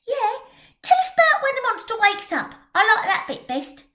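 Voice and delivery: put-on a street girl eager for the scary part; keen young girl, fast and bright; excited girl. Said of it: "That was Jenny Stocking".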